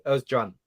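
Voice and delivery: Özcan said Öz-jan, soft J sound; the C in Özcan becomes a J; a J in place of the Ch; Turkish pronunciation. Shown as a demonstration. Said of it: "change your C to a J".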